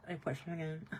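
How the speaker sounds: ordinary talk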